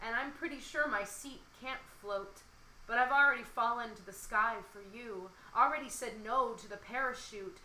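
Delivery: lively spoken-word poem recital; poem reading. Acted. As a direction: perform the poem to the listener. Reads her love poem aloud.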